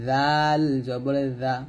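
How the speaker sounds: জাল held long, tongue tip on upper teeth edge; letter articulation demo. Shown as a demonstration. Teacher showing students the next letter, জাল slow and thin. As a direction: buzz a thin জাল slowly like "th" in this. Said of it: "আর এটা হবে".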